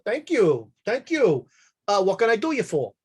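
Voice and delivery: lightly voiced cheerful saloon owner; friendly barkeep. GM voicing the bartender.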